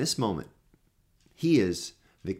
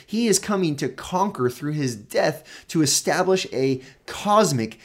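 higher, louder preaching build; full-force preaching; 4 semitones higher, 4 dB louder than usual. Acted. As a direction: drive the point home from the pulpit.

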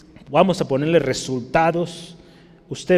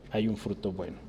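soft echo; 5 semitones lower, 10 dB quieter than usual. Shown as a demonstration. Preacher echoing his point softly to the church. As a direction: drop to a hushed repeat. Echoed softly after the first take.

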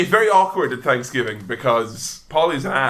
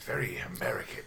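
whisper; 6 semitones lower, 15 dB quieter, faster than usual. Real, not acted. Echo whispered.

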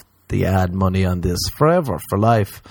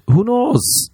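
high mock shrug; 13 semitones higher than usual.

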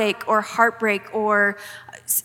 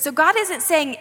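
normal talking voice

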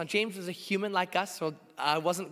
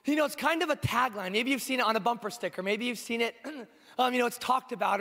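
usual speaking voice

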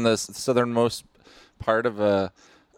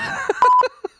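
very high, loud squealing laugh; embarrassed shriek of laughter; 6 dB louder than usual. Real, not acted. Caught out by the podcast racism call, squealing laugh.

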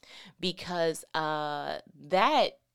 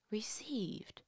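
whispered; 4 semitones higher, 9 dB quieter than usual. Acted. Preacher whispers key word.

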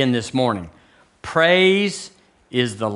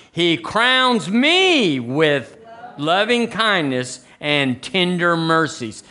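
slow, drawn-out, higher proclamation; led declaration; 3 semitones higher, much slower than usual. Real, not acted. Preacher leading the church in first-person scripture, slow declaration. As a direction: stretch each word for the congregation to repeat.

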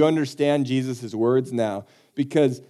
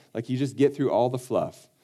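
ordinary talk

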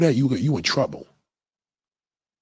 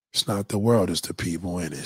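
drops to a low, soft murmur; a quiet, knowing repeat; 8 semitones lower, 7 dB quieter than usual. Acted. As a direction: let it sink in softly. Repeats line, dropping his voice.

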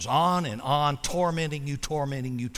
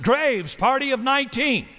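higher, louder announcement of "Graves, party of nineteen"; calling out a name; 9 semitones higher, 5 dB louder than usual. Retold or imitated. Restaurant host announcing the table, calling out loud. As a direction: hostess calls "Graves" and "nineteen" high and loud across the lobby. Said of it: "is the announcement".

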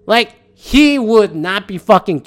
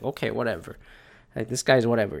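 dismissive mutter; 8 semitones lower, 10 dB quieter, faster than usual. Retold or imitated. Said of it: "it's just like".

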